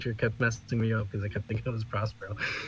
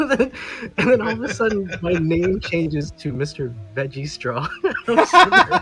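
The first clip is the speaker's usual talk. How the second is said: laughing; 6 semitones higher, 9 dB louder than usual. Real, not acted. Name changed to Mister Veggie Straw.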